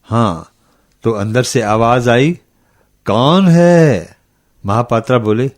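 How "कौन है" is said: loud, high, long-drawn challenge; calling from inside. A narrator's reading. Old woman answering a knock at night, drawn-out call. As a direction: stretch it out high, calling to a stranger.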